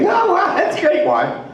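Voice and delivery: high, loud eager squeal; excited. Retold or imitated. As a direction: burst out with delight. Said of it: "And I'm like".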